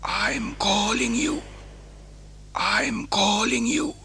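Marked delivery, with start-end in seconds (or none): none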